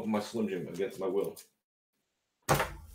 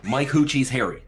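higher, louder, words run together; quick run-together reading; 4 semitones higher, 5 dB louder than usual. Pretend. Trying a gag phrase on friends, quick run-together reading. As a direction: say it all in one quick burst.

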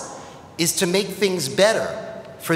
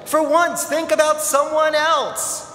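crowd scolding; 7 semitones higher, slower than usual. Acted. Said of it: "they shouted".

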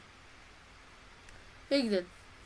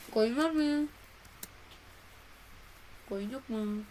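ordinary delivery

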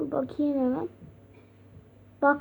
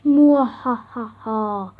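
evil slow laugh; 3 semitones lower, 4 dB louder, slower than usual. Acted. Villain reveals his plan.

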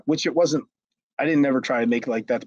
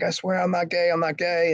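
mildly pleading, higher-pitched denial; imitated tearful protest; 6 semitones higher than usual. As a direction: mimic someone protesting through tears, understated. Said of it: "and he's crying".

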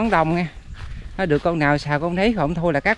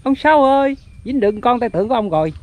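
calling out; 5 semitones higher than usual. Real, not acted. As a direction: sing out to him excitedly. Calling out to uncle over a catch. (gọi với, giọng cao)

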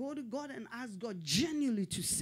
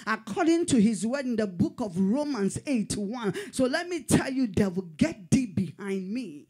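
loud, driving sermon climax; loud preacher rebuking the devil; fervent preaching; 6 dB louder than usual. Acted. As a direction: build it into a rebuke.

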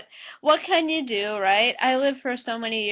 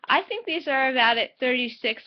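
lip pulled aside by finger; speaking with cheek stretched open; finger in mouth corner. Real, not acted. Seen 1: Fingers stretch the mouth corner open while she speaks, released by 2.3.

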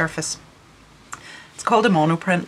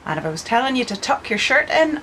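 normal talking voice

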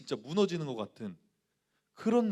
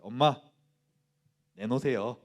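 demanding; 3 semitones lower, 6 dB louder than usual. Retold or imitated. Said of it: "그랬어요".

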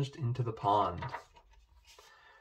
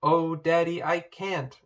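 higher-pitched, mildly protesting plea; reluctant pleading; 7 semitones higher, 5 dB louder than usual. A narrator's reading. Poppy begs her daddy to be let off, pleading.

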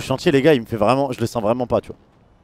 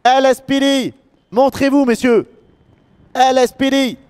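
echoing; 10 semitones higher, much slower than usual. Real, not acted. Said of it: "Ça résonne là-dedans".

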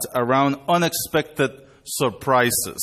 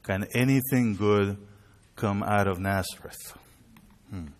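doubtful; 4 semitones lower, 5 dB quieter than usual. Acted. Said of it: "receive the news with doubt or hesitation".

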